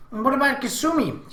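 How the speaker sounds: high-pitched challenge in a younger-sister voice; high, demanding sibling voice; insistent little sister. A narrator's reading. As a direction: press the question, pitched well up. Little sister presses the argument.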